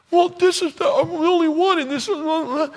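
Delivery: very high squeaky mock Elijah; frantic protest. Elijah answering God's question, frantic high-pitched protest.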